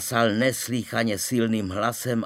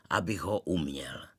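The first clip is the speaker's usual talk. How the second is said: ordinary delivery, nothing marked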